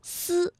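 丝 with lips pulled to both sides; spread-lip s. Shown as a demonstration. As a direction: spread the lips wide for a flat-tongue 丝. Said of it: "嘴唇呢就要向两边去拉开". (嘴唇向两边拉开读“丝”)

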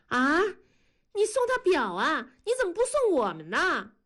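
put-on high-pitched little girl piping up; little sister. A narrator's reading. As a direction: pipe up high, like an envious kid sister. 小妹南方探头起哄. (小妹妹尖着嗓子起哄)

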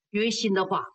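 ordinary delivery

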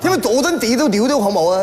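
garbled mock-Cantonese navigation; imitated Cantonese. Sat-nav babbles directions to lost driver, mock Cantonese. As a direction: rattle off directions in fake Cantonese. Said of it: "粤语的导航".

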